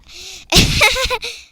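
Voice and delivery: high, loud odd cackle; loud squeaky giggle; weird laugh. Real, not acted. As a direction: burst into a strange witchy laugh. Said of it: "That was a weird laugh".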